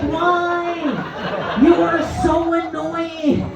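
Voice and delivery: high-pitched, drawn-out exasperated rant; exasperated complaint. Acted. Night-shift nurse to a dying elderly patient, exasperated.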